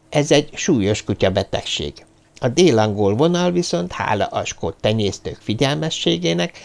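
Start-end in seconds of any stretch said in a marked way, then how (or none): none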